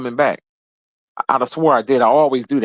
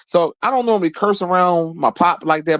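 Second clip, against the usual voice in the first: usual speaking voice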